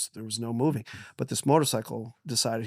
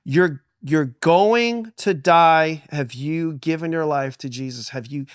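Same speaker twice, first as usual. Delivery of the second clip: urgent father; 4 semitones higher, 7 dB louder, slower than usual. Retold or imitated. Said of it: "And he's like".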